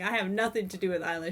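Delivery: ordinary delivery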